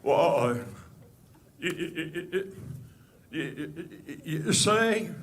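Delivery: a light, halting Jimmy Stewart stammer; Jimmy Stewart impression. Comic opens his Jimmy Stewart bit with a stammer. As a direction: stutter through it like a hesitant old movie star. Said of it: "here's my impression of one of the greatest actors in the world, Jimmy Stewart".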